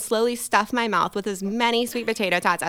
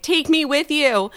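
desperate plea; 7 semitones higher, slower than usual. Retold or imitated. Pleading to leaving waiter.

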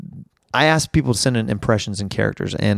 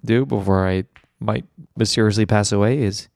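ordinary delivery

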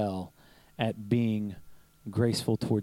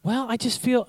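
coworker's protest; 12 semitones higher, 3 dB louder than usual. Retold or imitated. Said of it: "And she went".

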